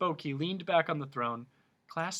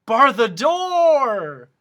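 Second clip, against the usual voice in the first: very loud, slow, higher; frantic humbug shout; 11 semitones higher, 16 dB louder, much slower than usual. A narrator's reading. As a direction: jump up and shout it. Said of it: "shouted the humbug".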